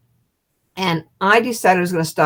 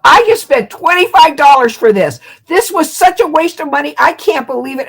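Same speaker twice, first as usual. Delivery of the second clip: louder, higher, fed-up inner rant; indignant; 8 semitones higher, 7 dB louder than usual. Retold or imitated. Stewardess ranting to herself about wasted money, indignant.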